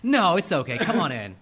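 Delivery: loud, carefree invitation; breezy girlfriend. Retold or imitated. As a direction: wave him in without a care. Voicing the girlfriend.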